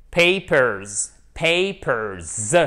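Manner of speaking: Papers with a clear final s; Papers, don't drop the s; clear plural s. Shown as a demonstration. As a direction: land the s at the end of Papers. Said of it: "on n'oublie pas le the pour faire le pluriel".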